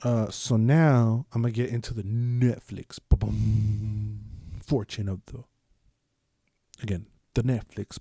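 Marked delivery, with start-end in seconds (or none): 3.1-4.2 drawn-out humming boom; imitated logo sound. Introducing the Netflix picks, imitated logo boom. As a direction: voice a streaming intro thump, let it ring.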